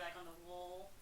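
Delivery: ordinary talk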